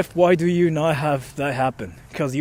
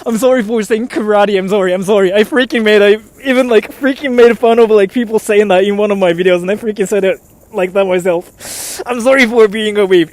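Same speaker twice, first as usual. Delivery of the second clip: mock-distraught apology; 3 semitones higher, 8 dB louder than usual. Mock-distraught apology after slipping.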